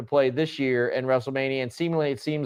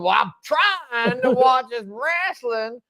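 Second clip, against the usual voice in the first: put-on much higher, slower old-lady whine; grandma voice; 9 semitones higher, slower than usual. Imitating grandma annoyed while watching wrestling. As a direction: drag it out like a fed-up grandma. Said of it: "where he's like".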